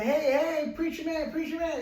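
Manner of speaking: high, urgent hail to a passer-by; acted calling out. Trapped man hails passing preacher.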